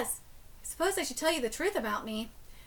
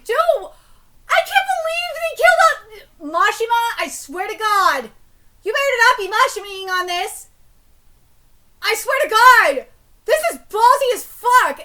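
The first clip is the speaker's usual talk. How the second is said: outraged rant; 5 semitones higher, 11 dB louder than usual. Real, not acted. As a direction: blow up at the translation. Reader rants at the translation.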